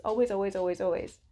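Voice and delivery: quick string of "Always, always"; rapid-fire repeats driving the point; insistent repetition. Real, not acted. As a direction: rattle off "always" in a run, one on another. Rattles off repeats after drawn-out one.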